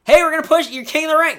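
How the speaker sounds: loud, high fake-eager promise; mock hype. Booker pumping up Wade Barrett, loud and high. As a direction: hype him up like a pushy booker. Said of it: "a guy who's like".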